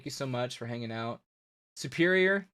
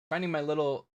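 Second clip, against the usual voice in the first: normal talking voice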